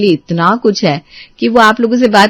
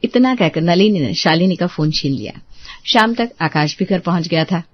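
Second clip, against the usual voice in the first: lower, even narration; story narration; 5 semitones lower, 4 dB quieter than usual. A narrator's reading. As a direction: step back and tell it. Narrator resumes after quarrel.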